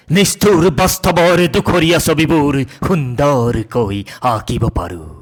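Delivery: poetry recitation. Acted. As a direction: build lists into high-pitched declamation, pausing between phrases. Poem recitation.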